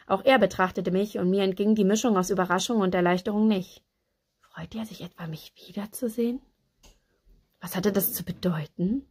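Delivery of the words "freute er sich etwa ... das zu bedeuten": whispered wondering; hushed inner thought. A narrator's reading. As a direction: wonder it under your breath, softly.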